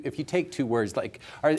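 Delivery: ordinary talk, nothing marked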